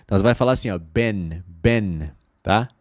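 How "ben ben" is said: "ben" with an open short E; low, clear short-E "ben"; short E sound. Shown as a demonstration. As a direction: say "ben" with E, never a long EE. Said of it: "vai ter som de E mesmo".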